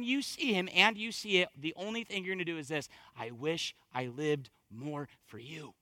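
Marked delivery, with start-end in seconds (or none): 3.2-5.7 low, slow and soft, wistful; hushed regret. Acted. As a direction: say it slowly, quietly, as a humbled confession. Imagined regretful words before Christ.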